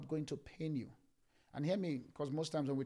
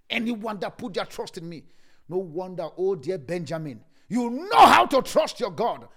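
tearful shout; 5 semitones higher, 16 dB louder than usual. Acted. Preacher climaxes, crying out.

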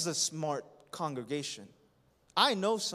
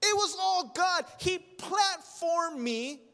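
grateful testimony; 9 semitones higher, 3 dB louder than usual. Acted. Preacher voicing a humble believer, high and fervent. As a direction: lift it up as heartfelt thanks to God.

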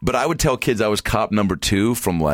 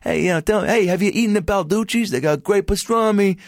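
high-pitched New York street heckler; yelling New York hawker. Retold or imitated. Park performer hailing passersby from a window, yelling New York greetings. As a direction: call out to passersby like a New Yorker. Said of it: "they'd yell at people who were walking by".

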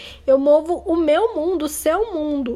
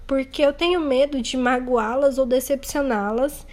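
usual speaking voice

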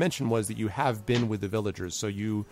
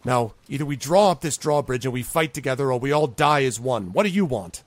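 forceful speech building louder to a raised final demand; urgent ultimatum; 3 semitones higher, 5 dB louder than usual. Acted. Zolkin urges the group to fight together, forceful ultimatum. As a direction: press them hard to decide now.

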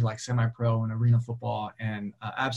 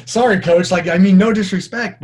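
louder, higher flustered apology; mock apology; 6 semitones higher, 10 dB louder than usual. Speaker apologizes to his old coach, loud mock protest.

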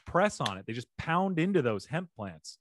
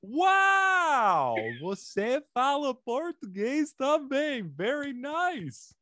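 much higher, slow and delighted; delighted surprise; 13 semitones higher, 3 dB louder, much slower than usual. Real, not acted. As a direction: gush with real delight at hearing Portuguese. Delighted reply in Portuguese.

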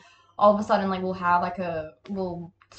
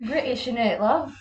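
mock British accent; 4 semitones higher, 4 dB louder than usual. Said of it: "a bit British".

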